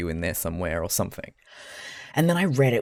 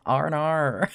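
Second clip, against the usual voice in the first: higher "R and R" in an American accent, mimicking Australian "oh no"; American doing Australian "oh no"; 6 semitones higher, 3 dB louder than usual. Shown as a demonstration. Streamer demonstrates [name]'s trick for "oh no", American accent imitating Australian. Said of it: "'Oh no,' like an Australian".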